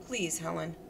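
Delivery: a soft, tender appeal; gently. Acted. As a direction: say it gently to calm her. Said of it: "And he said gently".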